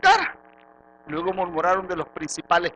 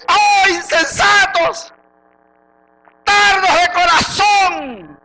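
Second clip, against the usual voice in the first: very slow, high, drawn-out shout; angry rebuke; 12 semitones higher, 11 dB louder, much slower than usual. Acted. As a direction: bellow it as the Lord scolding, stretching each word. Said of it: "el Señor te lo dijo bravo". (reprensión brava, a gritos)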